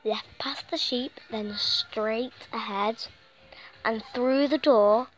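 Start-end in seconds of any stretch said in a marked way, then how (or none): none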